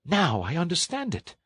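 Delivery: high, excited toad voice; high-pitched delighted discovery; Toad catching on. A narrator's reading. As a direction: pipe up with sudden realisation.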